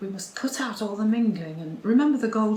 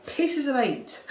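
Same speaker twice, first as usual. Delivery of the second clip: slow mock pirate accent; slow hammed-up pirate accent; pirate phrase; 6 semitones higher, 3 dB louder, slower than usual. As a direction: drag out a stock pirate cry. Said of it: "a few choice phrases like".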